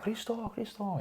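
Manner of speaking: put-on high, calling a lost son with a Greek accent; Greek mum calling out. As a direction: call out like a panicked Greek mum, soft and high. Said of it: "in a really Greek voice".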